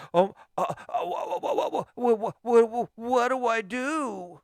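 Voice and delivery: high stammering mock panic, slowing at the end; flustered panic. Podcast host mimics a panicked listener, high stammering fluster. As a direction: stutter it out like someone who has no clue.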